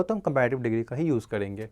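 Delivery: ordinary talk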